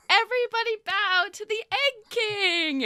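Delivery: chirpy festival host calling the crowd in; sugary village host, high and beaming; Lila the host. Acted. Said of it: "she's like".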